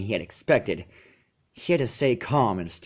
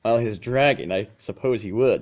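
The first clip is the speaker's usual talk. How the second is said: ordinary delivery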